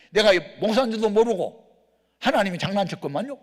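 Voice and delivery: high, loud indignant answer; a pastor's loud, stung retort to the doctor. Retold or imitated. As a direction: fire it back at him.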